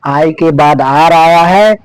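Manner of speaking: normal talking voice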